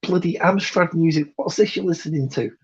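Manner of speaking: put-on high, incredulous old man voice; baffled old man. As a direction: squeak out in disbelief. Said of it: "And it's like".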